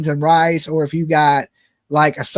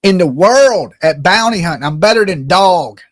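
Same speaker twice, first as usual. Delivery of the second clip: bounty hunter swagger; 7 dB louder than usual. Acted. Loud bounty hunter voicemail boast.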